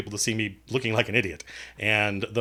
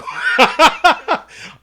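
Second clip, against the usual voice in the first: high, loud burst of laughter; big high-pitched guffaw; 9 dB louder than usual. Real, not acted. Laughs at admitting looking idiotic.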